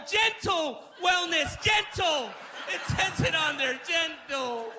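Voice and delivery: high, loud, slow shouting of gentle; ranting at the label's promise; outraged shout. Retold or imitated. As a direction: stretch gentle out in a yell. Ranting about the product label.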